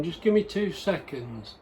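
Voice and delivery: normal talking voice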